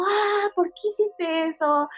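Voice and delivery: high, drawn-out mock reproach; retold angry accusation. Woman imitates her ex-boyfriend accusing her, high mock reproach. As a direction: stretch out a whiny-pitched accusation, kept light. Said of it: "me empezó a gritar".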